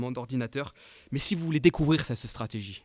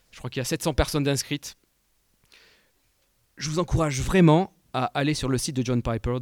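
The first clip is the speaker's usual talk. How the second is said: usual speaking voice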